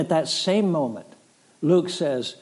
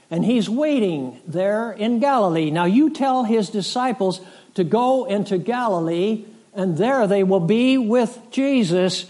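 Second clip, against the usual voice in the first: proclaimed announcement; 5 semitones higher, 3 dB louder than usual. Retold or imitated. Preacher voices angel's announcement at tomb.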